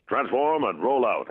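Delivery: imitated battle call; Optimus toy voice. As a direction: play the toy's recorded order. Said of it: "he pushes the button".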